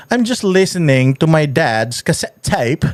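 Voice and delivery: slow, teasing British-accented imitation; mocking British accent, measured out slowly. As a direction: quote her in a drawn-out fake British accent. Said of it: "Kasi ang sabi niya".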